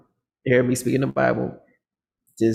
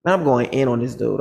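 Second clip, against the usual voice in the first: ordinary delivery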